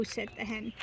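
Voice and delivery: ordinary delivery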